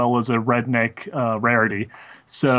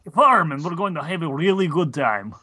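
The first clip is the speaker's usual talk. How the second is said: put-on Southern-Russian mashup accent; 6 semitones higher than usual. Asked to mix Southern with Russian, hams up a farm welcome. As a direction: ham up a Russian cowboy greeting. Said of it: "now mix Southern with Russian".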